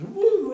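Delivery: put-on a sudden high, loud "woo" for the baby's flip; excited whoop. Retelling his baby's first roll, a high loud whoop. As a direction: whoop it out like the baby just flipped. Baby flips over, dad imitates.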